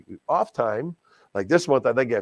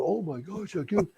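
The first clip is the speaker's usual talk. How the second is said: amazed crowd gasp; 8 semitones higher, 6 dB quieter than usual. Retold or imitated. Crowd reacting to big bid.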